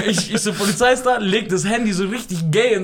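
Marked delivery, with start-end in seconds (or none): 0.5-1.2 high laughing alarm; laughing announcement. Retold or imitated. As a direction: blurt it out laughing. Retold warning, laughing, higher. (lachend gerufen)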